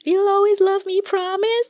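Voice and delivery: lightly mocking, high and sugary; lovestruck plea. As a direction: mimic a lovesick girl begging for a promise. Quoting the show's lovestruck character.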